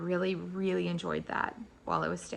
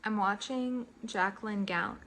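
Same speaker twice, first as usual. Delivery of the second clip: ordinary talk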